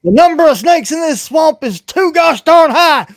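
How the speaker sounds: high, loud frustrated yell; exasperated shout. Real, not acted. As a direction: blurt it out exasperated. DM exasperated outburst.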